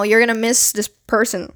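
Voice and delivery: ordinary delivery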